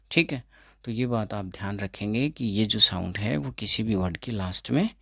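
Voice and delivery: normal talking voice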